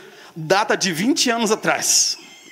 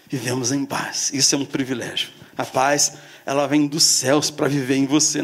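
ordinary delivery

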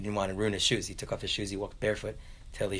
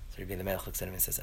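ordinary talk, nothing marked

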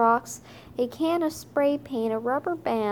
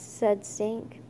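ordinary delivery

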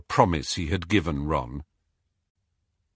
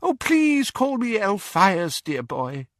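put-on much higher, slow, fluttery old man; high wheezy old wizard; 10 semitones higher than usual. A narrator's reading. Doge asks Harry to use his first name, high and wheezy. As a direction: float it up like a fussy old gent.

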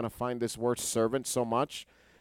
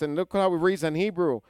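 ordinary delivery

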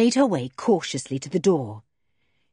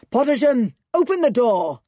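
louder, angry demand at a locked door; furious shout; 5 semitones higher, 5 dB louder than usual. Acted. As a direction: bang out the order in a rage. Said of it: "shouted Terry Kane furiously".

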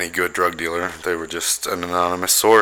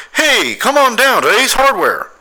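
high, slow radio-ad pitch voice; mock local radio announcer; cheesy radio ad; 4 dB louder than usual. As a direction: sell it like a corny local commercial. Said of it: "It was always about".

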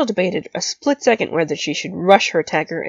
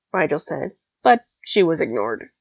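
usual speaking voice

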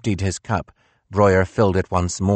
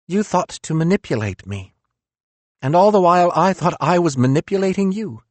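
higher, bitter philosopher voice; reproachful, raised-pitch Nietzsche; 11 semitones higher, faster than usual. Acted.

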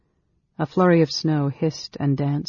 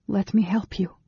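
lightly voiced woman, higher; a higher, helping tone; gentle helper; 6 semitones higher than usual. A narrator's reading. Amberol offers the ailing mage help, gently higher.

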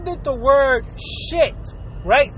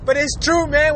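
ordinary delivery

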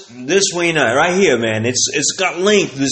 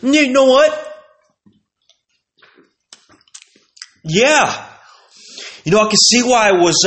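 mock pizza reviewer; 7 semitones higher than usual. Vlogger mocking the Instagram pizza guy, higher mock-critic voice. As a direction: ham up the reviewer's big verdict. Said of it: "takes a bite, goes".

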